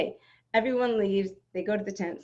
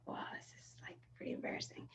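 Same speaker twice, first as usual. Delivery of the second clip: hushed embarrassed whisper; 20 dB quieter, faster than usual. Retold or imitated. Mother realizing her mistake, whispering to herself. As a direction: breathe it as an embarrassed aside. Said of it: "I'm like".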